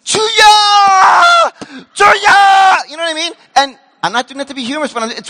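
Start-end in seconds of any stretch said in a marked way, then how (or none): 0.0-2.9 loud high crying shout; crying out prayer. Retold or imitated. Korean prayer meeting, loud anguished shout "Juya". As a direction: cry out to the Lord in anguish. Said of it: "you start banging".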